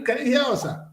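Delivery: normal talking voice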